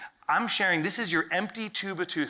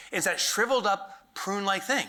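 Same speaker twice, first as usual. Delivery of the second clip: ordinary talk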